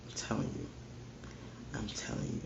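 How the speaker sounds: breathy whisper, leaning in; whispered warning. Real, not acted. Preacher warns greedy listeners in a hushed whisper. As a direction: let the warning drop to a whisper.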